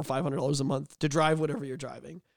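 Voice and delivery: usual speaking voice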